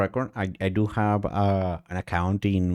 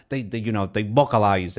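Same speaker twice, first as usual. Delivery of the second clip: ordinary delivery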